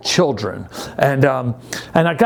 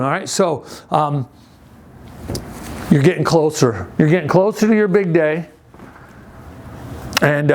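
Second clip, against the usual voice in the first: ordinary talk, nothing marked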